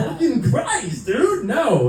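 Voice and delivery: very high, drawn-out exasperated protest; laughing, squealed denial; mock exasperation. Son answering dad's guess about tears, comic exasperation.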